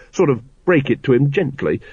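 ordinary delivery, nothing marked

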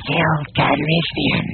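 slow nasal alien voice; nasal Glottalfib voice. Acted. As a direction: pinch it through the nose, slowly. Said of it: "in a remarkable approximation of a nasal Glottalfib voice".

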